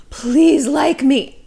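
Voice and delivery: slow, pleading little voice; needy pleading. Retold or imitated. Going on a date, begging to be liked, slow plea. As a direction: beg for approval, drawn out. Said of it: "with this attitude of".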